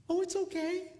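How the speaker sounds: a hint of the tearful lady, high and small. Crying lady voiced in story.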